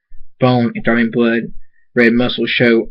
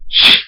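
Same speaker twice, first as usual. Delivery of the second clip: loud hissed "shh" of a blade; imitated slicing noise; 5 dB louder than usual. A narrator's reading. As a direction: mouth the sound of a blade going in. Imitates armor striking in story.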